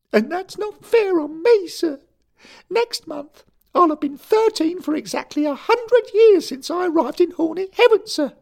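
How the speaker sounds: a furious choirboy insisting he's grown; angry schoolboy. A narrator's reading. Derek protesting to his boss, high indignant boy.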